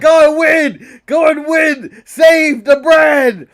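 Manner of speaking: loud, slow rallying shout; mock Triple H pep talk. Boss shouting orders at wrestlers to save the brand, loud and slow. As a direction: bark the order like a boss firing up his troops. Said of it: "Triple H can be like".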